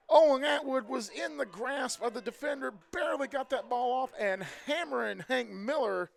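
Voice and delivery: loud, high, fast play call; excited play-by-play. Real, not acted. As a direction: shout the big run as it happens. Big play called live.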